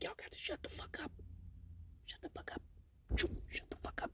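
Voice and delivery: hissed whisper to hush the crowd; urgent whisper. Retold or imitated. Stripper hushing crowding nurses, urgent whisper. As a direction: whisper it fiercely, all breath.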